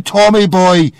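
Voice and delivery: ordinary delivery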